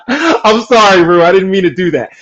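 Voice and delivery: real laugh in the words; laughing apology. Real, not acted. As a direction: apologize through leftover giggles. Apologizing straight after laughing fit.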